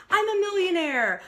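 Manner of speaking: high, loud, gung-ho declaration; mock affirmation. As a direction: proclaim it like an over-keen affirmation. Mimics someone declaring bold affirmations.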